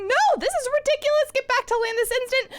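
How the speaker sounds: high, indignant command; scolding protest. Acted. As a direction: order yourself back in a shrill protest. Her mind orders her back.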